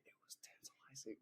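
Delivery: very soft ASMR whisper. Pretend. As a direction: whisper it right into the listener's ear. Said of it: "why you whispering in my ear".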